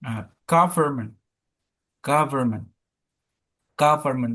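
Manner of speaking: government with the push on the middle syllable, gov-ERN-ment as the teacher says it; slow model of government, leaning on the middle syllable; teacher modelling the middle stress in government. Shown as a demonstration. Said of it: "yang ditekankan tuh yang firmnya".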